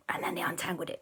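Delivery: hushed storyteller whisper. A narrator's reading. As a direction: breathe it out in secret. Bedtime story, tense moment.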